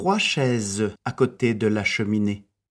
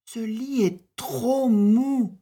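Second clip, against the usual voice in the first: put-on high girlish voice; a little girl complaining, high-pitched; Boucle d'Or; 12 semitones higher than usual. A narrator's reading. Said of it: "dit-elle". (voix de petite fille plaintive, aiguë)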